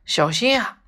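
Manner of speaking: high, sudden warning cry; shrill cry of alarm. A narrator's reading. Bear crying out high as the gosling falls, alarmed. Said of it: "不由得发出一声尖叫".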